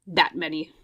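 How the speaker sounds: usual speaking voice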